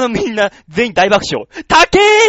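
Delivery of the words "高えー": much higher, loud shriek of surprise; mock shocked cry. Friends reacting to the pricey skewers, shocked shriek. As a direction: yelp at the price.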